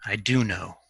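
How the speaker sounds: low, soft admission; hushed realisation. Acted. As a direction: let it sink to a quiet certainty. Dying man's quiet realisation.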